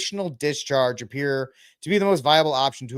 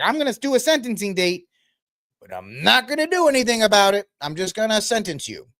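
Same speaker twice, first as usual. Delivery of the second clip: mock judge; 7 semitones higher than usual.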